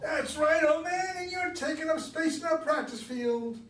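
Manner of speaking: put-on sneering high teenage punk, pushed loud; taunting teenage boy. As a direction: jeer at the old man like a smug teenager. Narrator voices taunting teenage boy.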